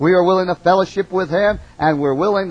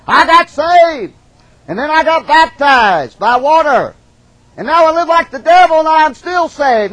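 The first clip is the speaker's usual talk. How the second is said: mocking hypocrite; 9 semitones higher, 6 dB louder than usual. Preacher mocking a hypocrite's boast to the congregation, loud and high. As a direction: ham up the smug sinner who thinks he's still saved. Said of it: "A person says".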